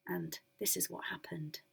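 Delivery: whispered lead-in to the tale; spooky whisper. A narrator's reading. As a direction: drop to a whisper.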